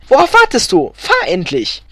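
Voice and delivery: high, loud impatient demand; impatient call. A narrator's reading. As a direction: call out impatiently at your husband. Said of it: "rief Elfriede".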